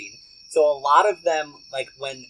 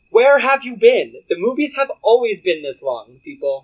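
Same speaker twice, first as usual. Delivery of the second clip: yelling; 5 semitones higher, 6 dB louder than usual. Real, not acted. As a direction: shout it in disbelief. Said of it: "the truth just yelled at you".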